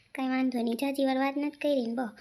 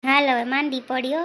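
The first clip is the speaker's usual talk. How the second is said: fast, louder scold; raised urging; 2 semitones lower, 7 dB louder, much faster than usual. Acted. Husband scolds Nisu to come in, loud and fast. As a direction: push her to come in now.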